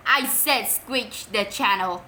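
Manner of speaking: plain reading voice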